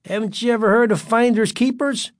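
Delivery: high-pitched taunting question; menacing. A narrator's reading. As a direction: loom over him with a mocking challenge. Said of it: "leaning over Greg menacingly".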